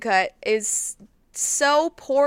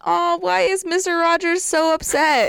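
mock confusion; 7 semitones higher than usual. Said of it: "I think people would be like".

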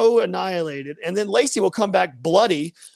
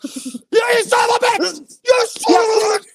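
very high shouted mock rage; imitated freak-out; 5 dB louder than usual. Imitating a friend raging at a game, screaming. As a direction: scream it like a fan gone berserk. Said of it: "he'll just start freaking out".

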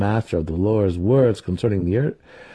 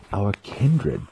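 sarcastic musing. A narrator's reading. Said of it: "Trist mused".

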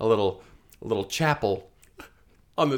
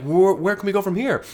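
lightly voiced panic; 9 semitones higher, 5 dB louder than usual. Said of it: "they're like".

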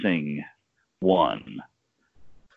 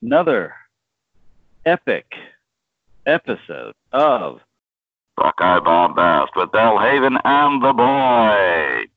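show intro announcer; 4 dB louder than usual. Acted.

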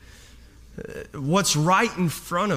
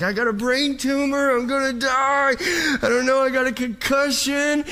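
panicked; 6 semitones higher than usual. Retold or imitated. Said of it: "I was like".

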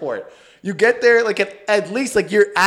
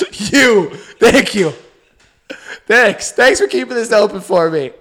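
louder, higher mock gracious thanks; mock game-show guest; 3 semitones higher, 6 dB louder than usual. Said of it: "saluting people like you're on The Price is Right".

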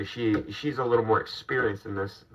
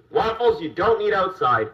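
scolding the dog; 9 semitones higher, 3 dB louder than usual. Real, not acted.

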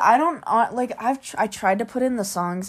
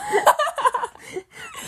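high giggle. Real, not acted. Laughs at dad story.

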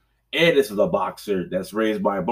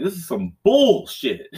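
higher-pitched mock frustration; retold fed-up complaint; 8 semitones higher than usual. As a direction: grumble it as if the game just cheated you. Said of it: "I like".

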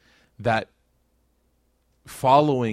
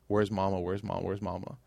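fading softer each time; quietening repeated where's Mama; son's crying plea; 3 semitones lower, 8 dB quieter than usual. Retold or imitated. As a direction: mimic a scared child's plea, trailing off. Crying son quoted.

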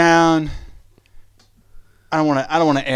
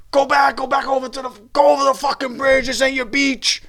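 lightly voiced tough locals, higher; mock hostile locals; 7 semitones higher than usual. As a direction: shoo them off the beach, jeering. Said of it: "everybody be like".

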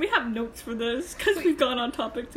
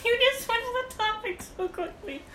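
high-pitched through laughter; laughing while teasing; 11 semitones higher than usual. Real, not acted. Laughing at abrupt topic switch.

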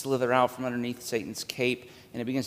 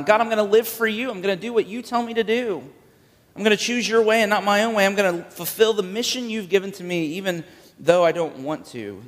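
higher and louder, driving resolve; impassioned preaching; 7 semitones higher, 3 dB louder than usual. Acted. Preacher voicing Jesus' vow to God, fervent and loud. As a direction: preach it like a vow at full force.

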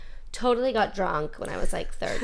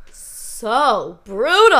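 emphatic; 4 semitones higher, 12 dB louder, much slower than usual. Real, not acted. Emphatic complaint about servers.

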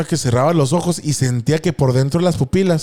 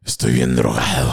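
voz muy grave y lenta de drogado; slower than usual. Retold or imitated. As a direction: lo dice grave y arrastrado como pasado. Stoned realization in mirror. (voz grave y lenta de drogado)

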